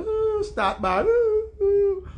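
high, drawn-out fake wail; mock bawling. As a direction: imitate a crybaby starting to bawl. Imitates woman starting to bawl.

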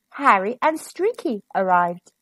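usual speaking voice

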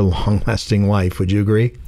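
ordinary delivery

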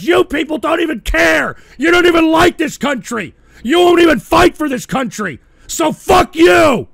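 real angry yelling, the last words slowed; furious shout. Real, not acted. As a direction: yell it at the people who won't fight. Ranting at the audience about America.

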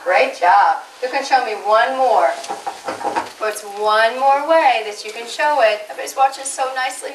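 ordinary talk, nothing marked

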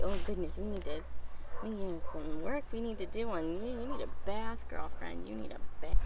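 hushed fond dog talk; soft murmur to dog. Real, not acted. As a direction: mutter fondly to the dog.

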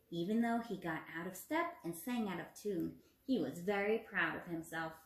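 put-on storybook narration for young children; expressive read-aloud for a classroom; reading a story aloud. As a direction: read it to the class like a picture book. Teacher reads story to class.

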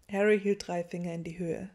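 ordinary delivery, nothing marked

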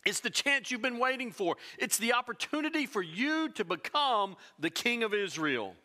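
ordinary talk

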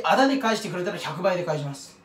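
ordinary delivery

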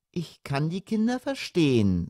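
softly indulgent; mild. A narrator's reading. Mother siding with the children, mild. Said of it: "sagte die Mutter milde".

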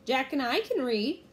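piping up. A narrator's reading. Annie volunteering to the pirates, lightly piped up. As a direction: pipe up over the others. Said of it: "Annie piped up".

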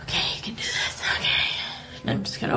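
whispered pep talk to herself; whispering. Acted. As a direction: whisper it, psyching yourself up. Nervous self-pep talk before door.

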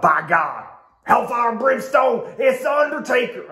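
high, shouting wrestling announcer; hammed-up ringside call, shouting; JR impression. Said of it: "that's an absolutely awful impression".

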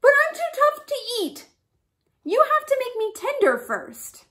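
high, loud frantic protest; cried out. A narrator's reading. Rabbit to lion about to eat her, crying out. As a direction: plead urgently at high pitch. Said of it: "She cried".

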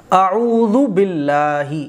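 اعوذ باللہ drawn out slowly with a clear ain; careful Quranic tajweed on اعوذ باللہ; correct recitation. Shown as a demonstration. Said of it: "حمزہ جو ہے وہ کھچے گا نہیں".

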